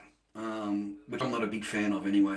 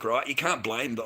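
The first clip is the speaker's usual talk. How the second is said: normal talking voice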